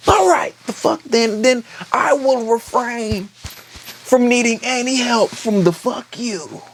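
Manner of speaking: high, slow, sarcastic huff; huffy refusal. Retold or imitated. Son to Corlys, a slow high huffy refusal. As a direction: drag out a petty refusal.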